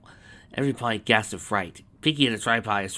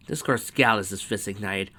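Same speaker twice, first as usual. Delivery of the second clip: ordinary delivery, nothing marked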